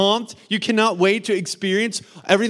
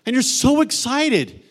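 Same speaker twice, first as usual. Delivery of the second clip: excited; 6 semitones higher, 5 dB louder, slower than usual. Acted. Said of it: "preacher pumping up the crowd".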